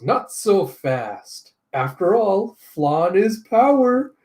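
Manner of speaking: slow, rising smug villain drawl; gloating villain. Acted. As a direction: savour every word.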